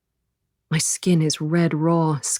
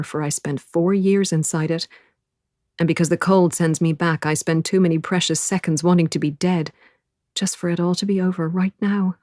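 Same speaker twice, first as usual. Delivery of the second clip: ordinary delivery, nothing marked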